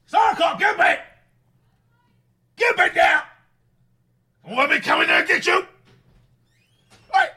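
loud high barking yell; shouting over telly. Acted. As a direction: bark orders at the kids. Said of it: "You were literally barking then".

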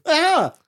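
high short yelp of a kicked sleeper; startled yelp. Acted. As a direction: cry out as if jolted awake. Sleeping goblin gets kicked.

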